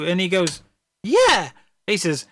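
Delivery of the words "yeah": high-pitched, eager reply; proud confirmation. Acted. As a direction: answer like a pleased sidekick. Other vampire's proud answer, acted.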